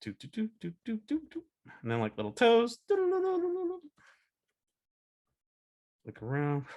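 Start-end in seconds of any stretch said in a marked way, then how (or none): 0.0-1.4 soft, high little doops; hushed bouncy footstep sounds; tiptoe noises. Acted. Imitating tiptoeing sound effects. 2.9-3.8 very high, rapid doo-doo patter; tiny cartoon toes pattering; squeaky little run of doo-doos; high scurrying trill.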